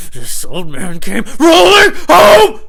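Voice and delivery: slow, high, building holler; screamed rhyme. Pretend.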